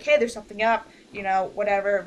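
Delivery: usual speaking voice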